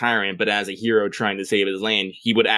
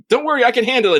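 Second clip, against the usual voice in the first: eager high-pitched quip; 13 semitones higher, 5 dB louder, much faster than usual. Retold or imitated. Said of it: "it's all like".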